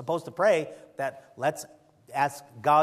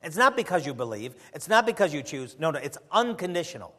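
ordinary talk, nothing marked

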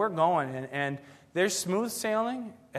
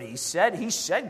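ordinary talk, nothing marked

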